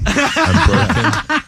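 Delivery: high, slow, drawn-out mock lament; mock anguished wail. To Elliot about the crying runner, hammed-up slow wail. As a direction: stretch it out like a hammed-up breakdown. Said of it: "I was like".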